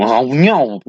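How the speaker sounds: imitating a silly French exclamation; mock French accent. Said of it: "you know, French".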